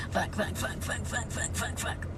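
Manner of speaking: breathless whispered string of curses; whispered swearing. Real, not acted. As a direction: hiss the curses under your breath. Shocked reaction to the story.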